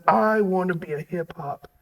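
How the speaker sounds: softly voiced daydream; dreamy inner voice. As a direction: muse softly like inner wishes. Host voices hopeful inner thoughts.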